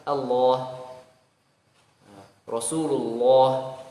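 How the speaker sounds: Allah and Rasulullah said very slow with a heavy lam; thick lam. Shown as a demonstration. Teacher shows the thick lam of Allah. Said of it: "tebal ketika sebelumnya fathah".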